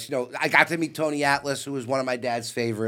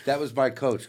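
ordinary delivery

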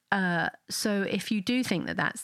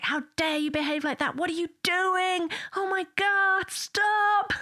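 exasperated yell; 11 semitones higher than usual. Retold or imitated. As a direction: build into a frazzled parent's outburst. Stressed parent snapping at child.

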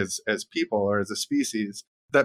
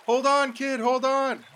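startled bystander; 13 semitones higher than usual. Pretend. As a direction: call out to the drowning kid. Bystander spots drowning kid.

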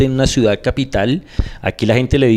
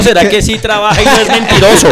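sarcastic, raised and louder question; mock sarcastic question; 6 semitones higher, 4 dB louder than usual. Mock dad's reasoning, retold. (pregunta sarcástica, más aguda y fuerte)

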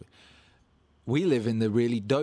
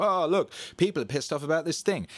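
higher, louder mocking media voice; mock alarm; 6 semitones higher, 4 dB louder than usual. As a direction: ham up a fake news alert. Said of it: "they go".

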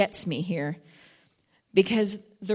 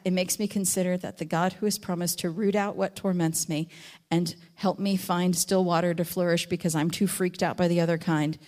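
ordinary delivery

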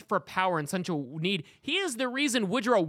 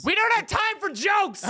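mock scolding shout; 12 semitones higher, 5 dB louder, slower than usual.

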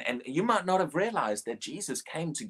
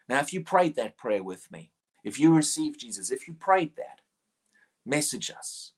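normal talking voice

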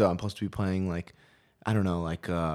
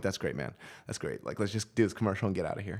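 muttered brush-off; 2 semitones lower, 10 dB quieter than usual. Retold or imitated. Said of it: "I'm like".

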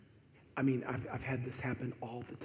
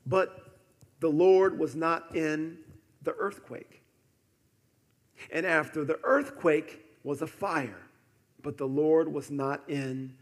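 loud, raised preacher's delivery; forceful scripture preaching; 5 semitones higher, 15 dB louder than usual. Acted. Preacher proclaiming earthquake and fire to the church, forceful scripture preaching. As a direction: proclaim each event with force.

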